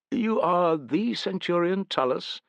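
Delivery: lightly voiced prim officer. A narrator's reading. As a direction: voice the oiled staff officer. Staff officer greets.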